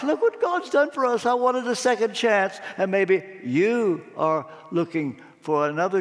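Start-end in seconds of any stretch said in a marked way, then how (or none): 0.0-2.6 high-pitched, excited rush of gratitude; overjoyed amazement. Acted. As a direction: marvel at your luck, voice lifted high. Apostles marvel at their luck.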